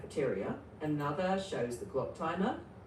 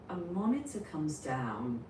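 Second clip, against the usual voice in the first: normal talking voice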